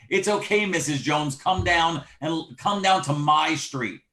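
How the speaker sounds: ordinary talk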